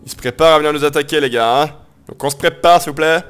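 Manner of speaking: rallying troops. Pretend.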